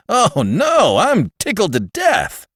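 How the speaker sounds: bitterly pretending delight; a touch of mock delight; sarcastic. A narrator's reading. Father's sarcastic reply.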